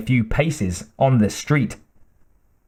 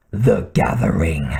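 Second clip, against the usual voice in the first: much lower, ominous villain; deep menacing voice; 6 semitones lower than usual. Acted. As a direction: let it rumble with dark relish. Kurgan's dark anticipation.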